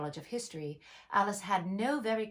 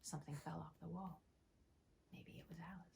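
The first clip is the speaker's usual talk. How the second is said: hushed whispered aside; breathy far-off aside; 2 semitones lower, 19 dB quieter, faster than usual. Real, not acted. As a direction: whisper it off-mic. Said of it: "unvoiced far whisper".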